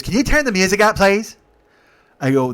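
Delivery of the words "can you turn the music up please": high, loud mock request; pushy demand. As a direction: imitate an impatient, demanding passenger. Retold passenger's pushy demand.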